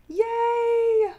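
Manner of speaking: normal talking voice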